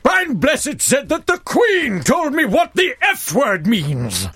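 high, slow, booming shout; roaring, drawn-out theatrical delivery; Brian Blessed impression. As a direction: proclaim it like a hammy stage actor. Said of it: "Can you say it in a Brian Blessed voice?".

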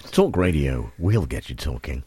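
very low, soft threatening voiceover; hushed, menacing station ident; threatening slogan. Acted. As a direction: drop it deep and quiet, like a threat. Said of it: "that's the way it is threatening".

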